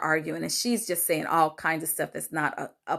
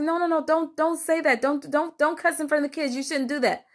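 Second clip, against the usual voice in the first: much higher, urgent retold plea to stop; retold frantic pleading; 9 semitones higher than usual. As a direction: beg her to stop, voice jumping up high. Grandma pleading to stop cussing.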